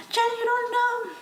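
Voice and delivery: high mocking whine; squeaky mock retort; mocking whiny voice. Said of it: "don't come at me and be like".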